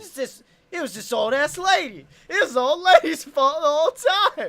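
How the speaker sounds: high, loud outraged protest; indignant blaming. Real, not acted. Son blaming old lady for the jam, indignant shouting. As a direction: protest loudly, passing all the blame.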